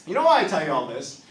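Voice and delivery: usual speaking voice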